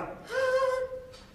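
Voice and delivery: very high breathy "haa"; leaky, airy falsetto. Shown as a demonstration. Teacher showing open vocal cords, high breathy falsetto. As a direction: let air leak through an open head voice. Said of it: "息が漏れてしまいます".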